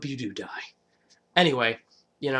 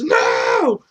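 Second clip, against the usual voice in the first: much higher, loud cry of no; anguished scream; 13 semitones higher, 9 dB louder than usual. Retold or imitated. Governor screaming as his daughter is threatened. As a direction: scream it in horror.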